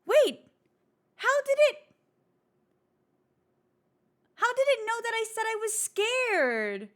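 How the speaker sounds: high, shocked disbelief; startled and amazed; surprised. Real, not acted. Shocked the AI heard her.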